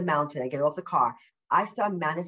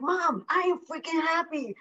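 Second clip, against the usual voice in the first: much higher, bubbly mock retort to mom; excited reply; 11 semitones higher than usual. Tells mom on the phone, in a much higher excited voice. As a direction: squeal how happy you are. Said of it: "I'm like".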